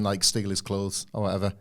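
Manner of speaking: usual speaking voice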